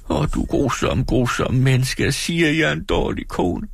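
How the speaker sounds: meget høj, såret pigestemme; såret bebrejdelse. Acted. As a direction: kast bebrejdelsen ud grædefærdigt. Dora klager såret. (Dora anklager sin mand, højt og såret)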